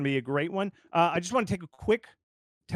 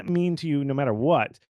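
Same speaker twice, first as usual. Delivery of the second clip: usual speaking voice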